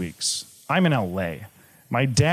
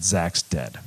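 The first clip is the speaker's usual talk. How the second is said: broken; 7 semitones lower than usual. Retold or imitated. Father telling his son the brother died, low and broken. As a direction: let the news crush you as you say it. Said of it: "I heard my dad just broken".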